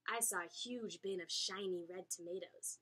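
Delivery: ordinary delivery, nothing marked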